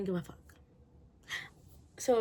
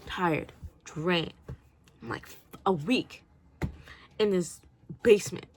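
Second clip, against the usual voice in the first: ordinary talk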